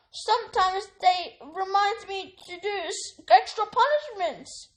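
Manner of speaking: high, anxious elf voice; a loyal servant confessing; house elf. A narrator's reading. Elf explains his family to Harry, high. Dobby voice.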